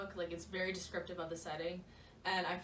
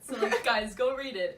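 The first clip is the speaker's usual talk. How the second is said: louder call to the viewers; loud urging; 2 semitones higher, 10 dB louder than usual. Real, not acted. Telling viewers to go read the book, loud. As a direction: urge them loudly to go read it.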